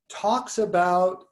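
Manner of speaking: ordinary talk, nothing marked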